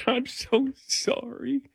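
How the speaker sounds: high, quiet, drawn-out tearful whimper; mock crying. Host acting out crying to a police officer, mock crying. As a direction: blubber a slow, high apology through pretend tears. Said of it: "I'm already crying".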